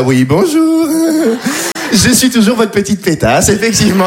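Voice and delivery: polite voice. Retold or imitated. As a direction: go up into the prissy customer voice. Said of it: "cette petite voix un peu plus aiguë".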